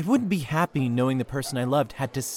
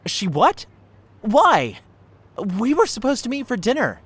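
higher and louder, startled disbelief; indignant shock at the news; shocked outburst; 9 semitones higher, 6 dB louder than usual. Acted. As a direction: blurt it out, caught off guard. Learns date went elsewhere.

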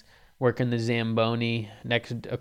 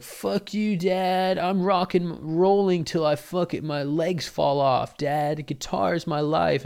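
higher, loud dramatic defiance; rebellious teen; 6 semitones higher, 3 dB louder, slower than usual. Retold or imitated. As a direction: declare rock'n'roll like a melodramatic teen. Teen rebels against dad.

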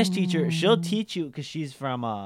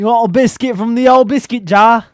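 high, loud mock British offer; hammed-up British accent, pitched up; 8 semitones higher, 10 dB louder than usual. Friend playing a Brit offering biscuits, hammed-up British accent.

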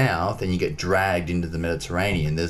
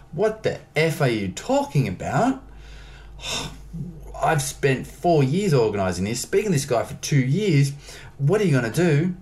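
frustrated inner voice; 8 semitones higher than usual. Retold or imitated.

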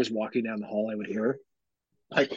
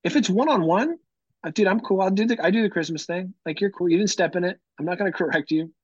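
higher-pitched easygoing let-off; breezy reassurance; 6 semitones higher than usual. Retold or imitated. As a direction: wave away their apology, voice lifted. Retold reassurance to apologetic greeter.

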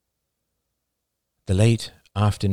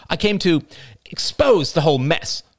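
high, emphatic retort; self-righteous, raised-pitch declaration; defiant; 10 semitones higher than usual. A narrator's reading. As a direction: fire back defiantly, pitched up. Rachel defiantly answers Ian's demand.

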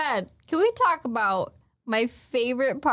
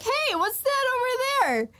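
high, sing-out decoy call; mock innocent distraction; 10 semitones higher than usual. Acting Nami's distraction ploy.

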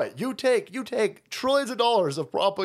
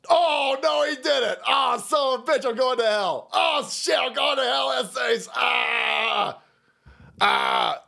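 shocked shouting; 3 semitones higher, slower than usual. Real, not acted. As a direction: holler in mock horror. Shouting reaction to clip.

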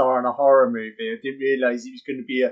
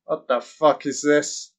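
ordinary talk, nothing marked

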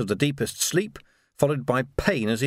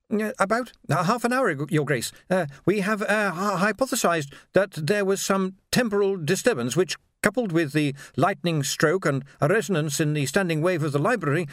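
higher-pitched, stammering scholar; hesitant academic; 6 semitones higher than usual. Acted. Ponder explains the disturbance to Vimes, hesitantly. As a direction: stumble through a technical explanation, pitched up.

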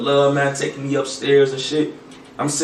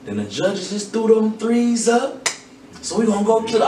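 imitating host Terrence; 9 semitones higher than usual. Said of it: "that motherfucker Terrence talking about".

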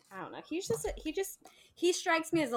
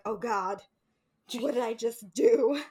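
mock horrified heroine; 6 dB louder than usual. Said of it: "Of course she's gonna be like".

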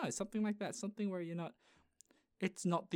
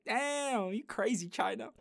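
mock amazement; 6 semitones higher, slower than usual. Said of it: "and you're like".

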